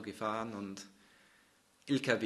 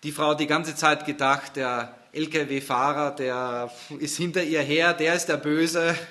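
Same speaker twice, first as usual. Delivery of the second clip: loud dramatic telling; 4 semitones higher, 10 dB louder than usual. Acted. Building dramatic story climax.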